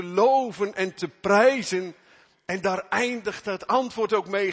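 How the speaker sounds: loud, high, fervent sermon voice; full-force preaching. Acted. Preacher urging the congregation toward joyful obedience, loud fervent preaching. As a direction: preach it out to the whole church.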